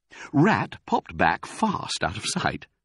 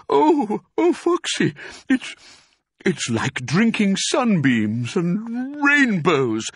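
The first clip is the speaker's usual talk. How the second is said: high, halting, then slow and swooning; gasping rapture; 11 semitones higher, 4 dB louder, slower than usual. A narrator's reading. Badger praises cider to Foxy, breathless rapture. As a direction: stammer into drawn-out bliss. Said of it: "he gasped".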